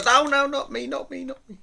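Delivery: high-pitched mock protest, fading in volume; camera-shy people. People in the park seeing a camera come out, high mock protest. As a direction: squeal a refusal, ducking out of the shot. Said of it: "people go".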